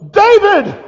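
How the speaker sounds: very loud, high shout of a name; booming divine call. Acted. The Lord calls a man on the road, booming shout. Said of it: "and the Lord goes".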